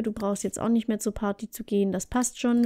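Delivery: ordinary delivery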